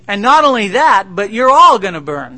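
loud, high, hellfire yell; preacher imagining a worker shouting at coworkers, furious shout; angry shout. Pretend. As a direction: blast them with judgment.

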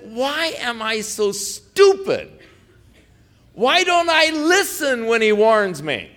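loud, high-pitched self-rebuke; mad at himself. Retold or imitated. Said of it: "I was so mad".

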